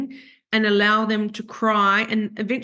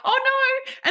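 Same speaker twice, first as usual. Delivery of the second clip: escalating mock panic; 12 semitones higher than usual. Retelling tears about to burst out, in mock panic. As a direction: pitch climbing into a high mock wail. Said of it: "and I'd go".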